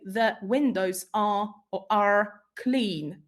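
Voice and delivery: "are" said in full and stressed. Retold or imitated. Teacher imitating learners who stress "are". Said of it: "So they would stress this word".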